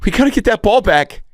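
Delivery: put-on high squeaky boy voice; kid voice. The main kid pleads to his friends, high boyish voice. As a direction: play the whiny kid begging for the ball. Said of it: "the main kid".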